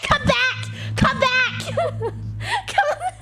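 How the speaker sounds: high mock shouting, breaking into laughter; retold yelling. Traveller calling after the man walking off, laughing mock yell. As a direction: call out high as if after someone leaving. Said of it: "I just kept yelling at him".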